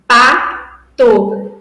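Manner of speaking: syllable demo. Shown as a demonstration. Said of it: "Ó, eu tenho".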